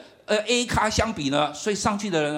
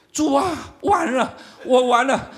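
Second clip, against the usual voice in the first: panicked prayer; 7 semitones higher, 3 dB louder, slower than usual. Retold or imitated. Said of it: "我当时就祷告".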